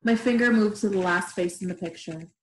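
plain reading voice

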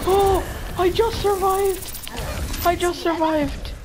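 high, slow, drawn-out relief; amazed at having survived; relieved exclamation. Real, not acted. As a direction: exclaim it high, stretching the words.

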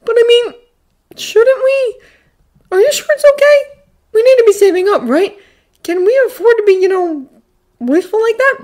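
put-on high, worried, halting kid's voice; sheepish boy. A narrator's reading. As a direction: ask hesitantly, unsure it's allowed. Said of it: "the boy asks a bit sheepishly".

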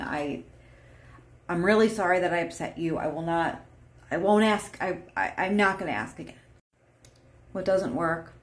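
normal talking voice

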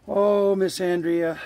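loud, slow, high call of her name; drawn-out call. Real, not acted. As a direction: sing her name out long to reach her. Calling the raccoon by name.

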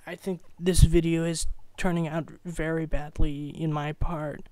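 ordinary talk

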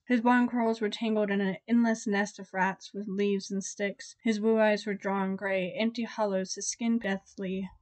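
ordinary delivery, nothing marked

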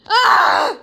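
short frustrated shout; frustrated yell. Real, not acted. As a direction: blurt out a fed-up cry.

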